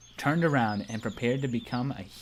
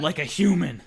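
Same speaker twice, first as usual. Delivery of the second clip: loud, higher rousing tone; loud, higher mock declaration; mock heroic; 5 semitones higher, 5 dB louder than usual. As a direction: keep the hammed-up vow going. Continues mock heroic line.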